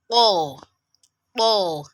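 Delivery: "po" thick from tongue and throat, loud; thick letter. Shown as a demonstration. Teacher introducing the consonant "po", thick and strong. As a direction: push a heavy, throaty "po" out with force. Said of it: "characterized by its thickness and the throat is involved".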